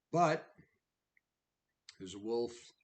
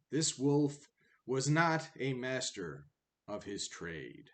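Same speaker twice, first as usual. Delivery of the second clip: declaimed storybook poetry, higher and louder than chat; verse fable reading; 5 semitones higher, 9 dB louder than usual. A narrator's reading. Narrator reciting a fable of fish, wolf and dog, declaimed verse reading. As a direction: read the rhymed fable out with measured pauses.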